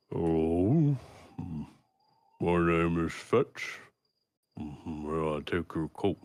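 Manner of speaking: lightly voiced ogre butler, slow and polite; a hint of a lumbering ogre offering to take a coat. Ogre butler greeting guests at the door, slow and polite. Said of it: "the ogre kind of extends a hand and goes".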